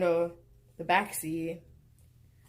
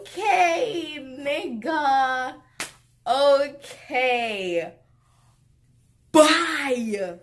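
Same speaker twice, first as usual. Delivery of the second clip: high, loud, sassy kiss-off; dismissive sign-off; 6 semitones higher, 4 dB louder, much slower than usual. Retold or imitated. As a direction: brush him off with a sharp high bye. Dismissive reply, ending it.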